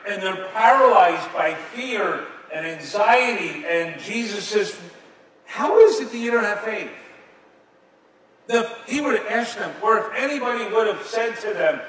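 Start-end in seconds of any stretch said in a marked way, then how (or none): 5.5-6.9 high, loud incredulous question; forceful challenge. Acted. Jesus confronting fearful disciples, loud reproachful challenge. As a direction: challenge them at raised pitch and volume.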